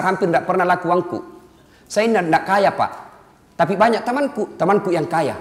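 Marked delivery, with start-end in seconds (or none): none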